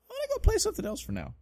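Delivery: pouting, sped-up brat voice; a spoiled kid giving up on a game; whiny quit. Retold or imitated. Quitting after dying on boss.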